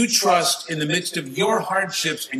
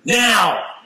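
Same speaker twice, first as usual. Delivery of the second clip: higher and louder, fed-up protest; exasperated outburst; 6 semitones higher, 4 dB louder than usual. Retold or imitated. Believer fed up with being told to be patient, exasperated outburst. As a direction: act out snapping back in irritation. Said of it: "you wanna just slap them".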